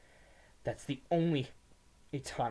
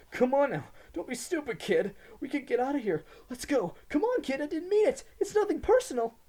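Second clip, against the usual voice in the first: much higher, louder desperate whine; whining plea; 12 dB louder than usual. A narrator's reading. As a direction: beg for your life, whining. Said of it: "I heard him whine a little at the end of his plea".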